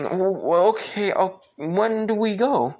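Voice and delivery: high-pitched flustered stammer; stuttering. Acted. Hinata asks Naruto about their date, stuttering. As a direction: stumble into an eager question. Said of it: "like, you know, stuttering".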